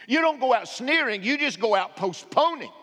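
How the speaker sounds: loud, high preaching cadence; preaching at full force. Acted. Pastor urging the congregation, loud and high preaching. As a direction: drive it home to the pews.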